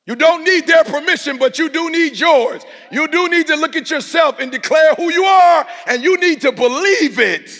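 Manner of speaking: high, loud, shouted; shouting preacher. Shown as a demonstration. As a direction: preach it at full force. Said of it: "soft take then shouted".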